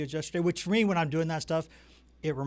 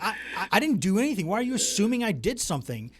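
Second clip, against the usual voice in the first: higher-pitched, stammering protest; lightly hammed-up wounded innocence; mock defensive; 7 semitones higher, 3 dB louder than usual. Parent retells being blamed by the kids, mildly defensive. Retold defence against kids' accusation.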